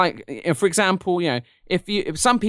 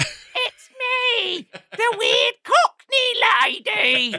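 very high, drawn-out mock Cockney lady; terrible Cockney accent; much slower than usual. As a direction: stretch it out in a hammed-up Cockney squeal. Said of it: "talks in this really terrible Cockney accent".

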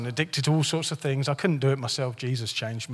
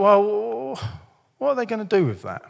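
cynical; 6 semitones higher than usual. Acted. Preacher mimics his own reaction to giving, mock cynical. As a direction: voice a suspicious giver, pitched up. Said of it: "being cynical and judgmental".

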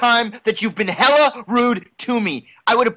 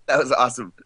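ordinary talk

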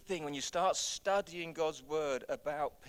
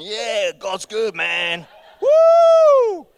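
hammed-up surfer dude, loud with a whooping high finish; mock surfer whoop; 10 semitones higher, 25 dB louder, much slower than usual. Pastor imitating church members surfing, loud whooping surfer. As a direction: play a stoked surfer riding the wave.

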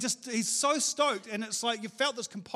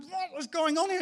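high-pitched baffled question; mock-bewildered and high; acted bafflement; 8 semitones higher than usual. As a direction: replay your disbelief, pitched well up. Acts out his bafflement in cafe.

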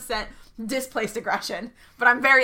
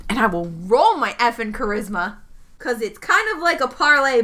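normal talking voice

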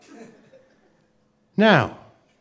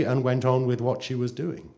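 ordinary delivery

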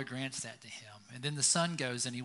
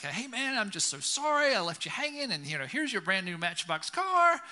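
put-on higher, pleading boy voice; kid's eager apology; 6 semitones higher, 5 dB louder than usual. Nine-year-old hands his friend a car, high and pleading.

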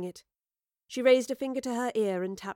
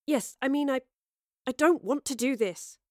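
flustered correction; 4 semitones higher than usual. A narrator's reading. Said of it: "then corrected herself".

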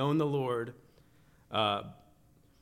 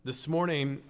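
usual speaking voice

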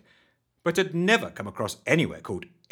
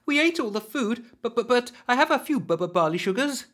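high nervous stammer; 12 semitones higher than usual. Acted. Rusty stammers in panic.

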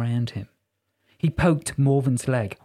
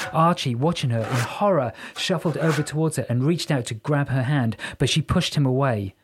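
ordinary delivery, nothing marked

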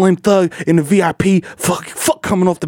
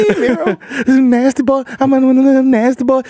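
playful high voice; 8 semitones higher than usual. Acted. Silly high voice.

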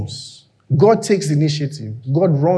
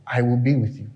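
soft reassurance; 5 semitones lower, 7 dB quieter than usual. Acted. God's soft reply.

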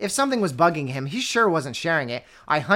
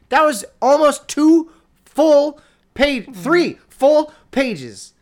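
exasperated wail; 9 semitones higher, 9 dB louder, much slower than usual. Real, not acted. Exasperated at the long passage.

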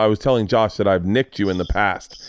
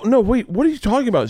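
high-pitched retold protest; acted flustered objection; exasperated disbelief; 11 semitones higher than usual. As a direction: pitch it way up, baffled parent. Exasperated parent correcting child.

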